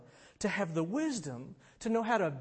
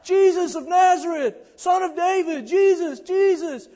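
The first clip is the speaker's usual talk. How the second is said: desperate shout, much higher and louder; crying out; 12 semitones higher, 13 dB louder than usual. Retold or imitated. Blind beggar shouting for Jesus from the roadside. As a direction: cry out to Jesus across the crowd. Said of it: "He starts crying out".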